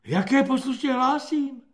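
high, indignant echo; outraged retort. A narrator's reading. Corporal jumping at the recruit's report, high and outraged. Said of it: "nadskakoval Freiter".

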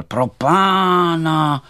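high, loud, drawn-out lament; slow wailing exclamation; crying out. A narrator's reading. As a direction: cry out in dismay, stretching the words. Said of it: "někdo vykřikl".

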